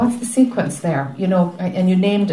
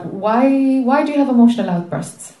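higher and louder, pressing the question; raised questioning voice; 6 semitones higher, 5 dB louder than usual. Shown as a demonstration. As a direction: ask it out loud, insistently. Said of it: "here's the sentence that was".

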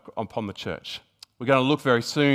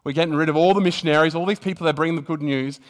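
louder, higher, quick imitation of a crackdown order; mock official ban, raised in pitch and volume; voice of China's rulers; 5 semitones higher, 7 dB louder, faster than usual. Preacher quoting China expelling missionaries, raised imitation of authority. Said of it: "China said".

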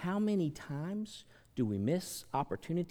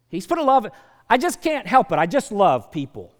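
much higher and louder, near a shout; shouted preaching; 6 semitones higher, 14 dB louder than usual. Real, not acted. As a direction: cry it out with full conviction. Preaching climax.

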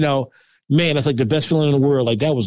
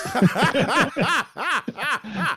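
long, high rolling laugh; real belly laugh; hearty laugh; 8 semitones higher, 4 dB quieter than usual. Real, not acted. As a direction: burst out laughing at your own line. Laughing at his own blunt answer.

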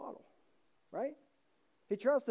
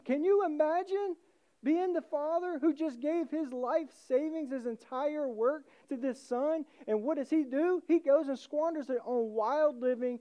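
fervent preaching; 6 semitones higher, 3 dB louder than usual. Real, not acted. Preacher stirs the congregation.

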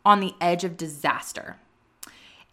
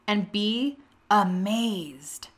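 slow, weighty reading of God's words; slow reverent reading of the Lord's reply; slow solemn scripture read; slower than usual. Shown as a demonstration. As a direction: read it slow and grave, as God speaking. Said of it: "And he says".

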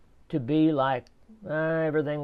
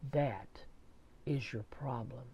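soft, whispered telling-off; a half-whispered, knowing remark; hushed verdict; 3 semitones lower, 13 dB quieter than usual. Retold or imitated. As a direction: drop to a whisper for the punchline. Repairman's quiet verdict, retold.

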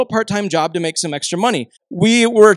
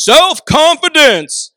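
high, loud, stretched-out singsong; triumphant announcement; 9 semitones higher, 6 dB louder, much slower than usual. Retold or imitated. Dad presents his son's promised gift, triumphant singsong. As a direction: proclaim it like a grand prize. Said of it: "I did it again".

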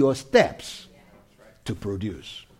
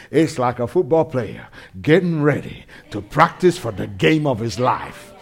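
usual speaking voice

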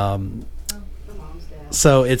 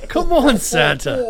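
laughing; 8 semitones higher, slower than usual. Pretend. Urging Santa with laughter.

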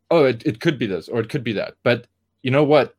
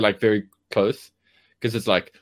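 ordinary talk, nothing marked

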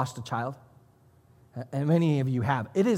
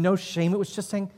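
usual speaking voice

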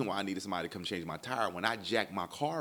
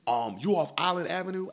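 high, louder mimicked question; irritated questioning; 11 semitones higher, 6 dB louder than usual. Tow guy asks lost caller, imitated irritation. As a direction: imitate the agitated driver on the phone.

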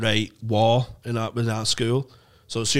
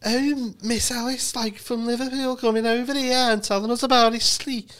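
high-pitched Scouse teacher voice; mock Liverpool teacher, pitched way up; teacher impression; 12 semitones higher than usual. Schoolboy doing his teacher for the bully, a high mock Scouse. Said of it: "I had to do, like".

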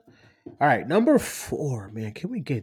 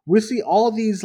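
ordinary delivery

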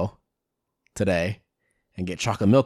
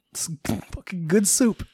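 put-on higher-pitched, playful Italian accent; mock Italian accent; 8 semitones higher than usual. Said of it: "I'm not Italian".

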